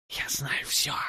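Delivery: unvoiced demonic rasp; a demon breathing a secret; demonic hoarse whisper. Demon tempts the necromancer, hoarse whisper. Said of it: "демонический хриплый шёпот".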